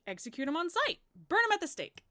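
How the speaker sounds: high, loud, rising outburst; emphatic exclamation. Acted. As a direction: build to a dramatic cry. Queen describing harsh villages.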